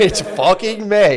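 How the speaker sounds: high, loud, drawn-out insistence; arguing back at a mock-furious pitch; mock quarrel yell. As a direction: stretch it out in hammed-up outrage. Acted sect quarrel over the month.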